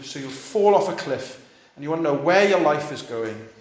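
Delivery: ordinary delivery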